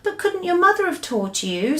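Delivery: put-on a girl asking innocently; little girl voice. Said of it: "Sophie asked".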